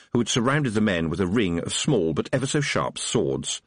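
usual speaking voice

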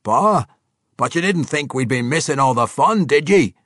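loud, high, boisterous dwarf bellow; a hearty dwarf calling out in delight; gruff dwarf. Acted. Boisterous dwarf Athrogate greeting his king, loud and gleeful.

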